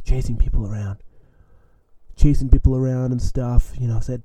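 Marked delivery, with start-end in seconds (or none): none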